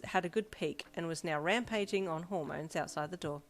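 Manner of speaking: plain reading voice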